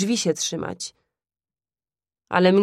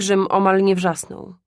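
ordinary delivery

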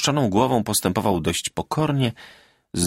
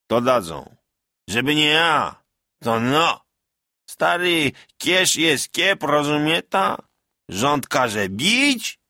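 higher, rough-edged village bragging; boastful peasant soldier; 7 semitones higher, 3 dB louder, slower than usual. A narrator's reading. Veteran Bartek bragging to the villagers, boastful. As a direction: crow about beating the French.

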